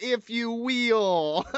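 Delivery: very slow, drawn-out "If you will"; mock Dusty. Cohost imitating fans copying Dusty, slowly. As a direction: stretch "will" out long. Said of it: "Everybody's like".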